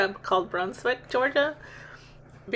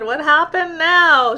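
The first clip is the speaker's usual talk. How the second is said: loud, slow, drawn-out exasperation; loud exasperated what now; 7 semitones higher, 9 dB louder, slower than usual. Retold or imitated. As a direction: throw up your hands at her. Exasperated question to stepdaughter.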